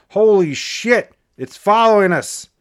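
loud, high-pitched exclamation; shouted alarm. A narrator's reading. Brother shouting to sister that the light follows them, shouted alarm. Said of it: "her brother shouted".

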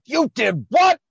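loud, high-pitched outraged disbelief; angry mock shout. Host retelling Bert's reaction to the call, angry mock shout. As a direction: yell it like a furious imitation.

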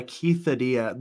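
"quesadilla" said as KEITH-uh-DEE-uh; punning pronunciation. Shown as a demonstration. Sharing a hilarious food name, "quesadilla" as Keith-adilla. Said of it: "it's hilarious that it's called".

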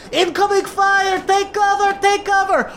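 high, urgent commander voice calling take cover; Ma Goblin shouting orders. Acted. Ma Goblin warns crew of incoming fire, high urgent shout. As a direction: bark the warning over the battle noise. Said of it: "and she goes".

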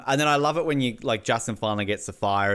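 usual speaking voice

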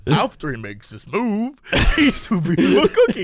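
exaggerated take-off of a weird narrator voice; mock creepy narrator. Mimicking the co-host's cookie line, mock creepy narrator. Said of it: "The way you said it was like".

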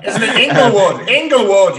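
laughing through the words; giggling correction. Real, not acted.

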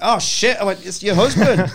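loud, high burst of realisation; high-pitched loud shock, re-enacted; retold shocked outburst. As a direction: blurt it out, mock horrified. Acting his shocked reply in story.

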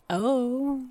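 usual speaking voice

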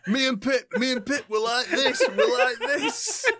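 high, laughing, hammed-up boast; laughing drunk boast. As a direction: imitate a tipsy mate bragging. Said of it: "He's going".

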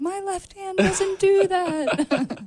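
high, slow whiny hand voice; squeaky mock voice. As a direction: voice her protesting left hand. Mock protest from her hand.

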